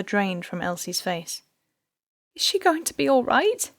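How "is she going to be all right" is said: unsteady. A narrator's reading. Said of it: "she asked unsteadily".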